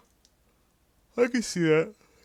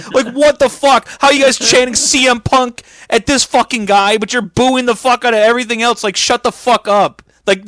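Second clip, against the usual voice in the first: higher, louder fed-up yelling; exasperated rant; 6 semitones higher, 3 dB louder than usual. Acted. Podcaster ranting at the Hammerstein crowd, loud exasperated yelling. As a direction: tear into the crowd, half joking.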